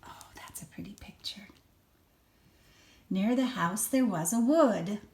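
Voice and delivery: usual speaking voice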